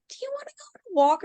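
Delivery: squeaky doggy-talk question to the pup; baby talk to dog. Retold or imitated. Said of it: "I say it in a very high-pitched voice".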